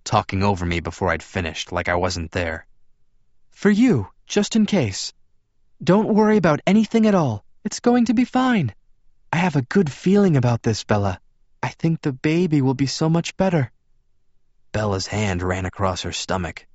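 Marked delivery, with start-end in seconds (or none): 3.5-13.7 high, emphatic female character voice; insistently upbeat, pitched well up; eager, reassuring woman. Acted. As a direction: push the reassurance in a raised pitch. Rosalie reassuring Bella.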